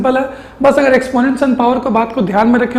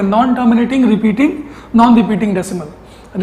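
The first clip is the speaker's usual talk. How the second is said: slow dictation; much slower than usual. Shown as a demonstration. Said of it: "तो इनको हम लोग क्या बोलेंगे?".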